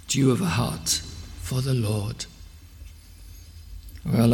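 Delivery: ordinary delivery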